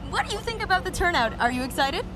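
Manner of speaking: normal talking voice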